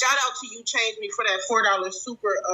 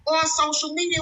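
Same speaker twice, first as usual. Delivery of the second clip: ordinary delivery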